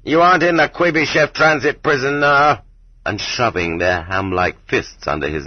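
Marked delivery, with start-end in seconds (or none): 0.0-2.6 raised, higher yelling voice; loud yell. A narrator's reading. Guards yelling at a naked new prisoner, loud and high. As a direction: bark it like a pack of dogs. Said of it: "yelling in unnaturally loud voices".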